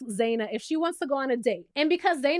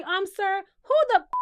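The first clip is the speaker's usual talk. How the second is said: much higher, louder outraged sass; sir, then explode; indignant; 7 semitones higher, 4 dB louder than usual. Retold or imitated. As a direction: rise into disbelief. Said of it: "The way I would have asked Scotty".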